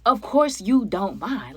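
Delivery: every word stretched out slowly and sarcastically; slow, pointed, sarcastic repeat; slow sarcastic drawl. Retold or imitated. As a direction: drag it out, mocking her words. Repeating girlfriend's words in irritation.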